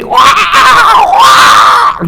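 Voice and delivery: high, loud shrieked wail; over-the-top high screech; shrieking demonstration. Shown as a demonstration. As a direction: shriek "wah" as a bad dawn-lecture example. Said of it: "dia tak bolehlah menyerik".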